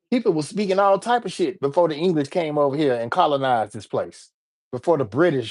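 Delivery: usual speaking voice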